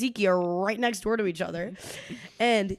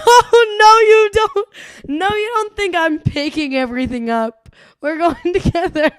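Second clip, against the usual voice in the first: laughing protest; 8 semitones higher, 9 dB louder than usual. Retold or imitated. Retold protest to boyfriend.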